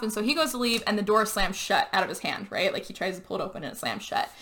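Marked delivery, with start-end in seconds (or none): none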